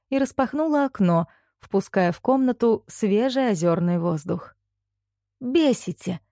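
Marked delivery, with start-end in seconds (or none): 5.4-6.2 higher, exasperated outburst without shouting; mildly angry. A narrator's reading. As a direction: vent annoyance, voice not raised. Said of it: "гневно сообщила она статуям". (сдержанно-гневно)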